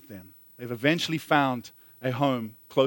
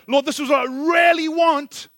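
high, loud plea with REALLY stretched upward; acted pleading; 11 semitones higher, 5 dB louder than usual. Believer negotiating with the Lord, high insistent pleading. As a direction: press the request hard, voice soaring.

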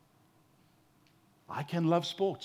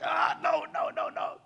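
put-on a kid pulling the splinter hand away; imitating a scared child refusing the splinter; childish protest. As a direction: squeal then breathy no's. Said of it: "And you're like".

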